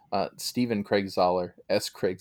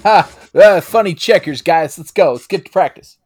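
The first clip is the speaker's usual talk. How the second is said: high, loud coach impression; mocking a goofy head coach, shouted high; Sean Gruden; 8 semitones higher, 13 dB louder than usual. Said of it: "I just wanna take a dig at Sean Gruden".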